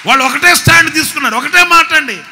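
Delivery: ఒకటే punched loud and high, fast; preaching shout of the same line. Shown as a demonstration. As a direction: hammer ఒకటే at the congregation. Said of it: "repeats the line louder".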